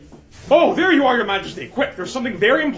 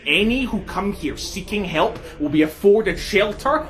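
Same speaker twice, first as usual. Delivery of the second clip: ordinary talk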